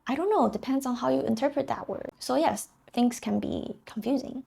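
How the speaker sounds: normal talking voice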